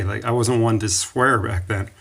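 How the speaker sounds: usual speaking voice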